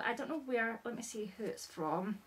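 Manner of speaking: ordinary talk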